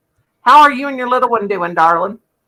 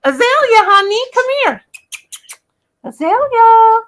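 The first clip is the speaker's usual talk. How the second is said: high, louder coaxing call; calling a pet; 11 semitones higher, 3 dB louder than usual. Real, not acted. Streamer calling Azalea over, high coaxing call. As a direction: sing out the name to bring the pet over.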